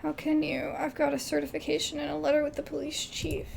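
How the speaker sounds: ordinary talk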